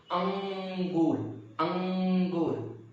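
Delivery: अंगूर said very slowly, the nasal अं hummed through the nose; slow teaching demo of अंगूर with nasal अं; nasal sound demo. Shown as a demonstration. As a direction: stretch अंगूर, humming अं before गूर. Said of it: "न से बोला जा रहा है".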